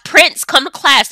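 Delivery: normal talking voice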